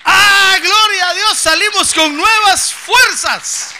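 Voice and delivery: slow, full-force preaching shout; shouted praise. Acted. Preacher bellowing praise at the congregation, full force. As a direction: cry out glory to God from the pulpit. Praise burst after joke.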